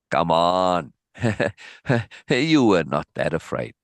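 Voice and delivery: ordinary delivery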